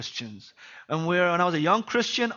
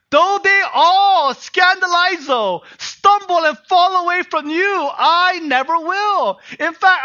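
bold boast; 12 semitones higher, 9 dB louder than usual. Retold or imitated. Peter boasting to Jesus he will never deny him, loud and proud. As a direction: declare it like a man sure he'll never fail.

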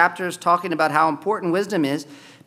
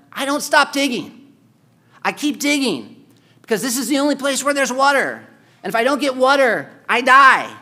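preaching emphasis; 7 semitones higher, 5 dB louder than usual. Real, not acted. Preacher raising voice on point.

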